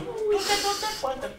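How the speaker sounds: small high voice, consonants swapped for T; imitated speech impediment. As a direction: mimic a tongue-tied boy answering in a high voice. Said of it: "Tateu, você faz alguma coisa".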